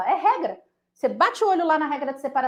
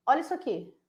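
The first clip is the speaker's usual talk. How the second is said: softly, a little above usual pitch; understated; 2 semitones higher, 5 dB quieter, faster than usual. Shown as a demonstration. Teacher showing students an error, understated and soft. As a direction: point it out quietly. Repeats the line, building up.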